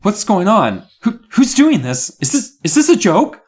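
high, stuttering alarm; fearful. A narrator's reading. Miss Carmen hearing a voice from the microphone, high and fearful. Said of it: "her eyes narrowed in fear".

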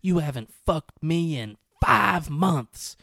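hammed-up public shouting; mock scream. Girlfriend humiliating Rolf in front of everyone, mock yell. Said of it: "screamed in front of everyone".

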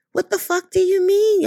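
high, incredulous retold comeback; mock indignant retort. Retelling a reply to mom, exaggerated indignation. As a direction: throw it back in disbelief. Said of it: "I didn't say it like that".

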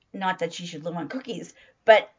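ordinary talk, nothing marked

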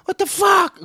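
put-on a stunned dad yelping at his kid's homework; exasperated outburst. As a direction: blurt it out at the wrong answer. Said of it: "I'm like".

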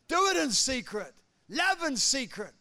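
loud, ringing preacher's proclamation; rising sermon fervour; fervent preaching. Acted. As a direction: preach it out to the congregation. Preaching at full force.

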